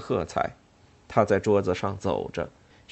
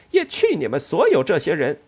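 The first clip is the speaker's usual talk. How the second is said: loud, higher curse at everyone; loud defiance; 6 semitones higher, 7 dB louder than usual. Acted. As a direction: curse the whole room. Ellen cursing the whole hall.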